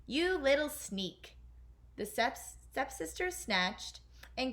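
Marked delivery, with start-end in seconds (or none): 0.1-1.4 higher, slow angry stepsister yell; slow, higher-pitched scolding shout. A narrator's reading. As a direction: spit it at the little sneak. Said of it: "shouted Drizella".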